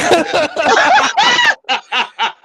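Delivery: high, loud burst of laughter; loud shrieking laugh. Real, not acted. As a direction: howl with laughter. Huge laugh after joke.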